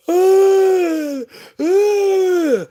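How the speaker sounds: high wailing sobbed uh uh; mock crying wail. Mock wail to the repo men. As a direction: wail like you're falling apart over the car. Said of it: "I was not out there like".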